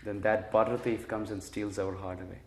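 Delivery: ordinary delivery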